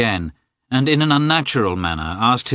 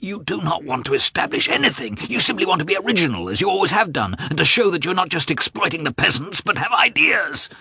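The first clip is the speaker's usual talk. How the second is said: higher, fast, scornful tirade; contemptuous brother in a quarrel; mocking accusation; 10 semitones higher than usual. A narrator's reading. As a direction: cut him down with scorn.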